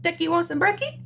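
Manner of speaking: high sing-song question to a little one; acted parent's morning call; parent calling child. Parent voiced calling son to breakfast.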